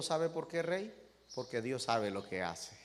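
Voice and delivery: a quiet, humble servant answers his king gently; humble servant, hushed. Acted. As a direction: speak softly, like a meek servant. (siervo humilde, voz baja y serena)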